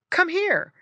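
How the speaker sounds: high, loud call to a dog. Retold or imitated. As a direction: call the dog over brightly. Calling a dog over.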